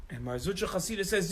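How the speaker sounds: ordinary talk, nothing marked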